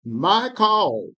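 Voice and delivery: measured, solemn Bible reading; scripture reading. Acted. Reader to study group, solemn scripture reading. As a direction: read the prophet's words with weight.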